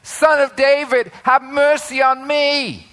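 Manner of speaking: high-pitched acted yell. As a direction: beggar calling out for mercy. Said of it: "He's yelling".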